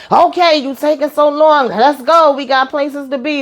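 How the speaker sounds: high-pitched impatient nagging, imitated; mock-impatient mama, pitched well up; bossy mama giving directions. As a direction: hurry them along like a fussy mama. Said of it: "yelling directions".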